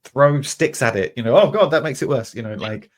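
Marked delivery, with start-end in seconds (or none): 0.0-1.1 mock frantic plan. Clueless person facing fire. 1.4-2.3 mock dismay. Fire gets worse.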